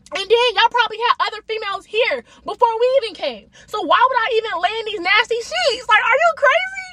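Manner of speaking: high-pitched, incredulous objection; indignant complaint. Retold or imitated. Storyteller replaying her refusal of the nasty sheets, high indignant complaint. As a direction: protest in high-pitched disbelief. Acted retort in a quarrel.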